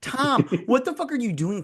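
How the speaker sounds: high-pitched mock outrage; exasperated scolding. Telling off Tom for his choices, exasperated.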